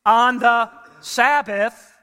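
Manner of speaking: On the Sabbath drawn out slow and loud, every word hit; slow punched Sabbath. Shown as a demonstration. Preacher hammering his point to the congregation, slow and loud. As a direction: land On the Sabbath slowly and loudly like a punchline. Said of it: "You see how I got my point?".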